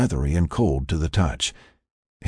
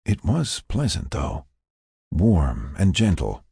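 ordinary delivery

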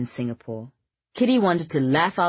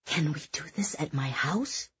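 soft breathy whisper to a friend; 7 dB quieter than usual. Acted. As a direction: whisper it so others can't hear. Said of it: "Kitty whispered to Oliver".

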